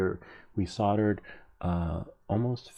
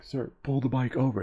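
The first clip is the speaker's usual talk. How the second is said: mock-angry cop, higher-pitched; mad officer; 6 semitones higher, 3 dB louder than usual. Officer repeats his order to cyclist, imitated mild anger. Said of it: "and he got mad".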